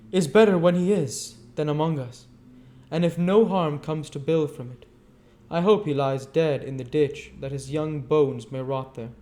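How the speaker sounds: put-on bitter young woman. A narrator's reading. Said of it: "said the girl, suddenly looking up".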